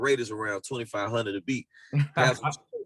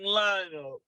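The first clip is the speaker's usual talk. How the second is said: slow, drawn-out, raised announcer call; arena announcer imitation; 6 semitones higher, slower than usual. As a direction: stretch it out like a lineup introduction. Evokes arena lineup introduction.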